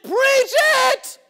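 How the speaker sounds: big shouted call, high and loud; retold shout. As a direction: yell it out like the man in the pew. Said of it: "He jumped up. He said".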